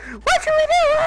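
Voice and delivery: squeaky high mock panic; panicked family. Family freaking out over his bleeding head. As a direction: screech it like a frantic relative. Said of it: "They're like".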